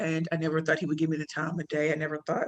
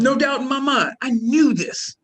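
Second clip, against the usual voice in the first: louder, higher, sure of it; emphatic, louder and higher; emphatic certainty; 7 semitones higher, 7 dB louder than usual. Real, not acted. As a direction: insist you knew all along. Excited certainty about failing.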